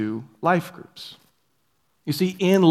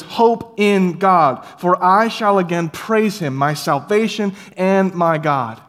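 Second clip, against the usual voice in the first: drawn-out, louder psalm chorus; slow triumphant refrain; 4 semitones higher, 5 dB louder, slower than usual. Acted. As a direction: proclaim it slowly with hope. Psalm refrain repeated slowly.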